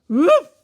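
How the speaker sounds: loud barked woof; dog bark. A narrator's reading. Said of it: "and barked".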